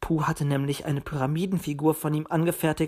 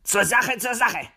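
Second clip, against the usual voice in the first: higher, brisk interjection; calling to order; 6 semitones higher than usual. A narrator's reading. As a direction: cut in to get them back on topic. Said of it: "lenkte der Fuchs ein". (beschwichtigend zur Ordnung rufend)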